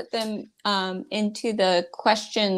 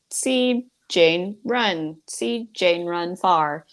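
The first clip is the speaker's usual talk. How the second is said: slow, word-by-word reading of a beginner book; mocking a dull early reader, drawn out; stilted early reader; much slower than usual. Shown as a demonstration. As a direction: read it out like a clunky first reader, slowly. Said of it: "often those books are like".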